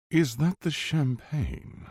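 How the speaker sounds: high, slow posh English drawl; posh English accent. A narrator's reading. Intruder asking bodyguard about champagne, posh English accent. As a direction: lift it into a plummy posh English voice. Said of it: "the man asked in a posh English accent".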